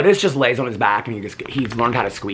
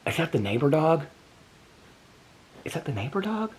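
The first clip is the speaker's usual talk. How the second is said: surprised; 4 semitones higher, 8 dB quieter than usual. Real, not acted. Gasp, surprised at window.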